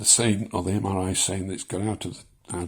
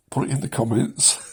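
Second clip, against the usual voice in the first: normal talking voice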